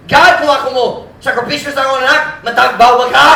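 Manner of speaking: high, loud sarcastic challenge; sarcastic attitude. Acted. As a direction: needle God with bitter sarcasm. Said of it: "Do you sense the attitude?".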